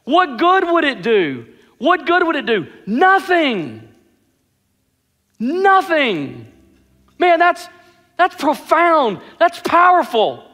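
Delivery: high-pitched, driving sermon delivery; impassioned preaching. Real, not acted. Preacher urging the congregation to love God, impassioned preaching. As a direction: preach it with rising fervor, punching the key words.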